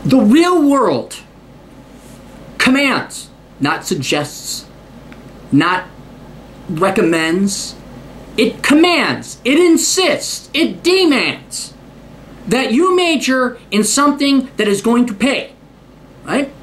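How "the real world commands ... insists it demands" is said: each verb weighed out slowly; slow and emphatic. Real, not acted. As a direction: hammer every word down slowly. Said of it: "slow emphatic declaration".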